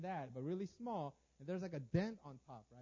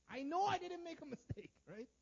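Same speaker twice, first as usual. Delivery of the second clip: high, loud, worked-up insistence; hammed-up aggrieved outburst; indignant protest; 10 semitones higher, 5 dB louder than usual. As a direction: burst out in high-pitched self-justification. Flesh protesting against own apology.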